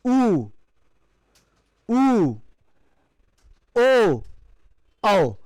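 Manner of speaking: उ, उ, ओ, औ voiced loud from the back of the mouth; vowel demo. Shown as a demonstration. Teacher showing vowels from the back of the tongue, loud. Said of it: "पीछे की तरफ से आवाज को निकाल रहे हैं".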